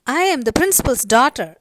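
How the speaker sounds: loud, mock-furious announcement; indignant. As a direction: declare it loudly as an offended schoolgirl. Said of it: "So looking furious".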